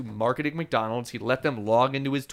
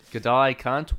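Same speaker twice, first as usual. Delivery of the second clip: mock Australian accent; slower than usual. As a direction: throw it out like a cheeky Aussie. Saying words that are easy to say in Australia.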